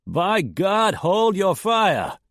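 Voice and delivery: loud, high, slow bellow; bellowed order. A narrator's reading. Man bellowing at the shooters to stop. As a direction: bellow it across the canyon. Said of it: "a man bellowed".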